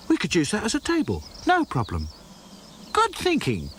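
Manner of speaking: put-on girlish high voices; chirpy doll chat; bright doll voices. A narrator's reading. Dolls excitedly planning their picnic, in bright high voices.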